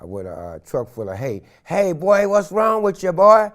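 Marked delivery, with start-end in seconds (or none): 1.6-3.5 high, loud country drawl of a farmer; old farmer voice. Retold or imitated. Farmer in a hay truck calling to a running man, country voice. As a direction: call out from the truck.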